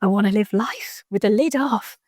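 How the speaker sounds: slow, drawn-out realization; a eureka moment. Retold or imitated. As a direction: savor the idea as it dawns. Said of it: "And I was like".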